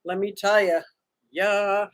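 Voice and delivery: normal talking voice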